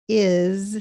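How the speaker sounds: IS ending in a buzzing Z; Z sound. Shown as a demonstration. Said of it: "you hear the Z sound on the S".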